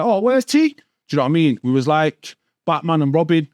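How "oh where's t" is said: imitating the lads. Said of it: "they'd be like".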